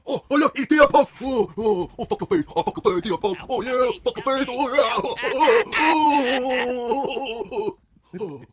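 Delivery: high, loud, slurred hooting. Acted. Fan attacking Punk, loud high slurred hooting. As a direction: whoop in hammed-up thick excitement. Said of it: "a Down syndrome fan that beat up Seth Rollins".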